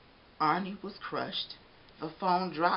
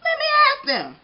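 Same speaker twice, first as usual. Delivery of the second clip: jolly baker voice; 11 dB louder than usual. Acted.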